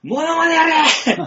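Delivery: high, slow holler; re-enacted shout. Showing how often he had to order Ichimura, high drawn-out shout. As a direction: yell the order again, stretching the end. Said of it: "何回叫んだか".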